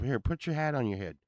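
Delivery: ordinary delivery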